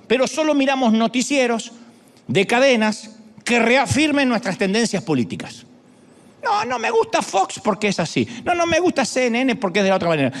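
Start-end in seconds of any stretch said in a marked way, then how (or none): 6.4-10.3 high-pitched picky objection; mock fussy refusal. News watcher rejecting channels, in mock fussy refusal. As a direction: lightly parody a fussy complainer.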